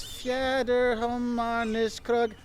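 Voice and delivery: Fjäderhöjmarniskrog sounded out very slowly, syllable by syllable; reading out a Swedish word. Shown as a demonstration. Tourist slowly sounding out a Swedish sign. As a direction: spell out Fjäderhöjmarniskrog slowly and carefully. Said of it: "Like this over here says".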